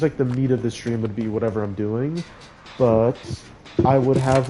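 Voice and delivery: normal talking voice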